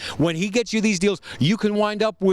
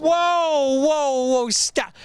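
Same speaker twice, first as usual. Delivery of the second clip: surprised; 8 semitones higher, much slower than usual. Acted. Host stops salesman in amazement.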